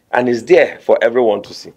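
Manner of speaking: usual speaking voice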